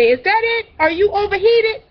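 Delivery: put-on high-pitched questions to a little child; imitated nanny babying. Nanny fanning a fussy girl, babying questions. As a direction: pitch it right up, as to a toddler.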